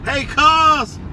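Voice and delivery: loud, high-pitched hail; calling out a greeting. Real, not acted. Hailing cuz during roll call, calling out loudly. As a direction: holler hello to a favorite cousin.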